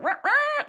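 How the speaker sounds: high, laughing rah rah of a startled crowd; mock crowd gasp. Speaker mimics startled coffee shop customers, high mock gasp. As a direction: squeal it like a shocked Starbucks crowd. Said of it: "Everybody's like".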